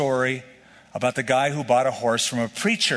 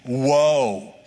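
grieving woe; 3 dB louder than usual. Shown as a demonstration. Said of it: "An expression of grief".

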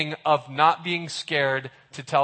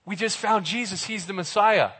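excited Philip; 3 semitones higher than usual. Retold or imitated. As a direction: spill the news to your friend. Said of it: "He gets all excited".

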